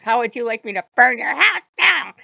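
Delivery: loud, hammed-up threat building to a yell; mock threat. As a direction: imitate a trickster god yelling a threat. Loki's retold threat in a quarrel.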